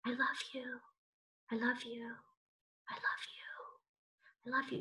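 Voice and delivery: whispered, barely audible loving words; soft whisper. Shown as a demonstration. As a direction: breathe it out as a tender whisper. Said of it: "It was nice and quiet".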